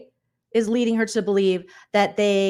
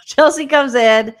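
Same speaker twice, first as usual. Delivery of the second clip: louder, higher entrance call; dramatic announcement; 4 semitones higher, 8 dB louder than usual. Acted. Recapper redoing a housemate's entrance, loud and dramatic. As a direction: belt it out as a big entrance. Redoing line after laughing.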